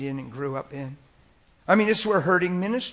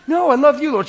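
much higher and louder, pleading; loud protesting John; 12 semitones higher, 13 dB louder than usual. Retold or imitated. John insisting to Jesus, loud and high. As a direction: cry out your love, over the top.

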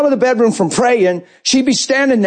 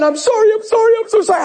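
high, pleading imitation of a crying wife; imitated high weepy sorry; tearful apology; 13 semitones higher than usual. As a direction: repeat it in a rush of mock tears. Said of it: "She's saying".

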